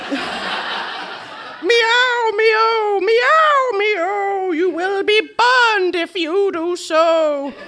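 high meowing cat, slow. A narrator's reading. Old cats begging Harriet to stop, high cat voice. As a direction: plead like a worried old cat. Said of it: "the cat voice".

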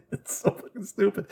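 high, helpless laughing voice. Real, not acted. Retelling the drunk guy's nonsense to a friend, laughing. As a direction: squeeze the words out through laughter.

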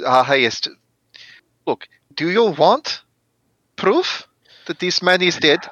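usual speaking voice

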